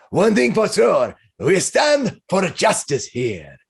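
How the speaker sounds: high, slow, proclaiming character voice; hearty heroic declaration, words stretched; boisterous champion. Acted. Balding man declares his cause to the bar, rousing. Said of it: "He says".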